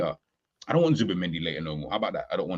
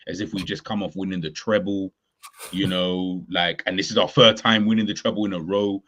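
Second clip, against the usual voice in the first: normal talking voice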